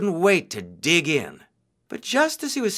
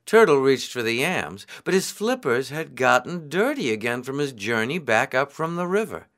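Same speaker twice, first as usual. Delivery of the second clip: ordinary delivery, nothing marked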